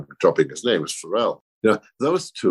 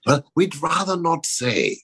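higher, drawn-out coy reply; coy evasive quote; 8 semitones higher than usual. Retold or imitated. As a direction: quote a slow, careful dodge. Quoting son's evasive reply.

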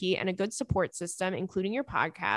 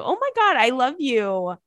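high, loud burst of delight; overjoyed at the sweet email, squealing; excited squeal; 3 semitones higher, 6 dB louder than usual. Real, not acted. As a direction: gush it out, thrilled. Touched by listener's praise.

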